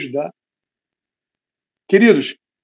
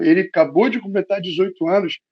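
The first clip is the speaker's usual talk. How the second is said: ordinary delivery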